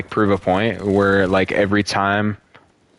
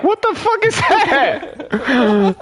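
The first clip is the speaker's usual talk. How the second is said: high slow laughing disbelief; laughing outrage; much slower than usual. Real, not acted. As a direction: squeal through laughter. Laughing outrage about wife.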